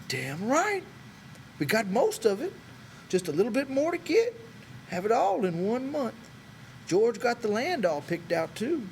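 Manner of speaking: high, quick boasting; Candy proving the money is real; proud eager old man. A narrator's reading. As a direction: tick off the plan with pride.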